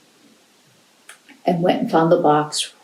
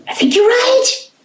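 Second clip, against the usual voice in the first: very high excited little cat; squeaky cat voice; 4 dB louder than usual. Acted. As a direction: chirp it way up high. Cat character agrees in story.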